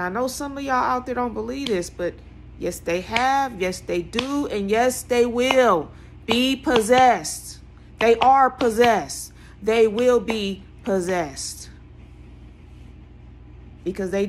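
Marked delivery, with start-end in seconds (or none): 2.6-11.7 slow, loud, each word pressed down; emphatic preaching. Real, not acted. Preacher insisting to doubters, slow and loud. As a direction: land every yes like a verdict.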